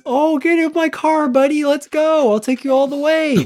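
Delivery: high, cheery buddy voice; friendly driver. Pretend.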